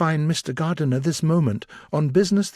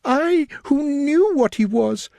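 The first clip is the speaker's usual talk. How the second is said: much higher, agitated voice; more agitated; 12 semitones higher, 3 dB louder than usual. A narrator's reading. Elizabeth blaming herself to Darcy, agitated. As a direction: let the agitation climb. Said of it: "in a yet more agitated voice".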